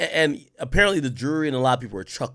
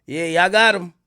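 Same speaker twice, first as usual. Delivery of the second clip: raised, loud mock congratulations; sarcastic; 5 semitones higher, 5 dB louder than usual. Mimicking the lawyer's closing to the jury, loud and sarcastic. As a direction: cheer them loudly, dripping with sarcasm. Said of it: "He was sarcastic in the closing".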